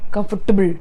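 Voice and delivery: COMFORTABLE in heavy separate syllables; wrong "comfortable", hard T before "able"; mispronunciation. Shown as a demonstration. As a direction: say "comfortable" as "kum-fort-tuh-bul". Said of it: "which is not correct".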